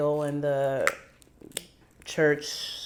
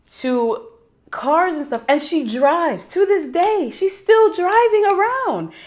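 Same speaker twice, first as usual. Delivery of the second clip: high-pitched incredulous astonishment; amazed disbelief; 10 semitones higher than usual. Real, not acted. Granddaughter amazed her grandmother still drives, incredulous. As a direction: pitch soaring, marvelling at it.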